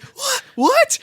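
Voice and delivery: very high, squeaking double "what"; stunned disbelief. Retold or imitated. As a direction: gasp it like a starstruck fan. Retelling reaction to famous names.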